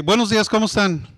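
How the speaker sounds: quick, upbeat morning hello; cheerful greeting. Shown as a demonstration. Supervisor greeting workers on Monday, cheerful and quick. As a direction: greet the crew like it's a good day. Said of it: "El tono de voz cambia la magnitud".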